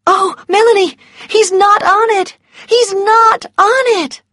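high, loud joyful cries; overjoyed relief. A narrator's reading. Scarlett tells Melanie Ashley is not on the list, overjoyed. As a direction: exclaim it in a rush of relief.

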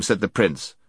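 plain reading voice

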